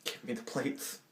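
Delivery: hushed mock menace; restrained threat. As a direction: demand it almost in a whisper. Quiet threat to plate thief.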